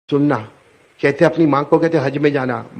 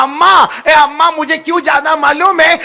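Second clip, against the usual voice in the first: crying out; 10 semitones higher, 6 dB louder than usual. Acted. Child's pleading climax.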